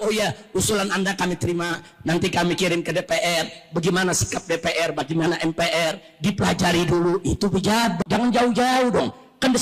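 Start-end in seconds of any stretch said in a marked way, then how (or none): 0.0-7.2 lower-pitched, composed official's answer; measured official reply. Acted. Official replies to retired generals' proposal, measured and lower.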